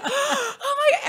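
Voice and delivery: high, loud gasping mock shock; imitating a shocked gasp. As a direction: play the friend's tearful shock, half-sobbing. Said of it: "she's like".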